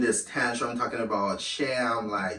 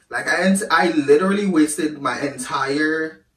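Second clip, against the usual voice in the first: venting real frustration; real anger; 5 semitones higher, 6 dB louder than usual. Real, not acted. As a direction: voice jumps up, louder, exasperated. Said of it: "I'm getting pissed".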